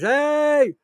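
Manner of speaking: high, drawn-out "hey". Shown as a demonstration. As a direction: raise your voice in a sustained, high call. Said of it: "I'm raising my voice".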